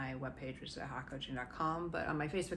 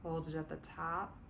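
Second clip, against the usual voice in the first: guided meditation. Acted. Coach guiding listeners through body relaxation, gently soothing. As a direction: ease them down into calm, step by step.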